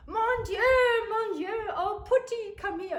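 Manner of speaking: high, loud, doting cries; fussing French grandma. Retold or imitated. French grandma calling the wet child to come for a hug, high and doting. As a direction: call the child over like a worried granny. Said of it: "my French grandma".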